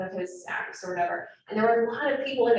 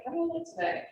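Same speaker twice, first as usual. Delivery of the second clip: vain self-check; 8 semitones higher, 4 dB quieter, faster than usual. Retold or imitated. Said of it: "you're like".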